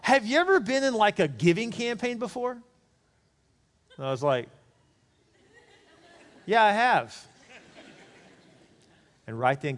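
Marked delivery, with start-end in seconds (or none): 0.0-2.6 mock a keen, chatty traveller; friendly stranger. Airport stranger greets the pastor, higher eager voice. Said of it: "he says". 6.5-7.2 a laughing, resigned yes; laughing reluctant yes. Retold or imitated. Pastor admits giving campaign to stranger, laughing.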